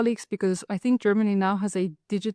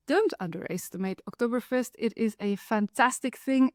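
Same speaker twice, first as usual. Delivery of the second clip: ordinary delivery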